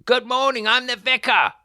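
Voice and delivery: shouting. Acted. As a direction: bellow it at the unanswered door. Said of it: "What am I shouting for?".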